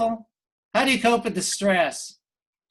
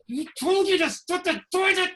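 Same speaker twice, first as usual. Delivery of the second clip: put-on sudden high snap at a kid; snapping at a toddler; 11 semitones higher than usual. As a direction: bark at the toddler mid-call. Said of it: "mutter under her breath between gritted teeth".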